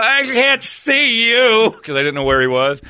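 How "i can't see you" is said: high, loud, drawn-out retold cry; panicked yell. Retold answer to the hidden officer, panicked high yell. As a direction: call out blindly into the lights.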